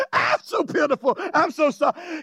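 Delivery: hammed-up sorry wail; mock crying. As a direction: imitate a sobbing penitent. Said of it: "snots on the altar and cries".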